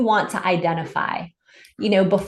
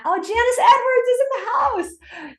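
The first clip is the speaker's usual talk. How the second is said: excited shout-out. Real, not acted. Greeting a chat participant excitedly.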